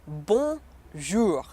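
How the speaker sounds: "Bonjour" split slowly into bon, jour; syllable by syllable. Shown as a demonstration. Teacher showing learners the word, slow split French "Bonjour". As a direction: model "Bonjour" in French, pausing between syllables. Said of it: "just say it".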